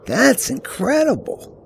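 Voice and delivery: put-on slow, high-pitched teenage wonder; awed amazement. A narrator's reading. Teen gazing at fourteen thousand foot peaks, drawn-out awe.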